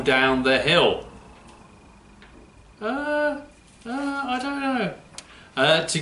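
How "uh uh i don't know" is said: higher, softer puppet dog voice; the puppet shrugs off the riddle. Acted. Puppet George answers the riddle.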